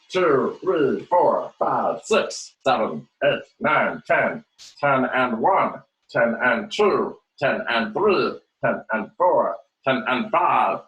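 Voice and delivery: teacher leading the class in a wacky silly voice. Shown as a demonstration. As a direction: ham up the count like a goofy cartoon. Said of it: "We'll do it in our wacky voice".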